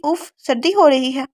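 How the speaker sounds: drenched and freezing, slow and loud; shivering with cold. A narrator's reading. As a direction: shiver through the words after cold water. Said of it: "وہ بالکل کانپ گئی".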